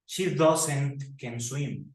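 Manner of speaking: drawn-out mistake; slow wrong example. Shown as a demonstration. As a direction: stretch out the error. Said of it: "no decimos".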